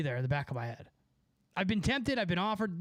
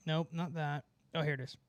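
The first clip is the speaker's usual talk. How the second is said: ordinary talk, nothing marked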